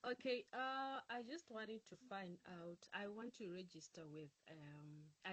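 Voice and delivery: very faint, far from the mic; barely audible. Real, not acted. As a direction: voice faint and distant on the call. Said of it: "you sound very faint".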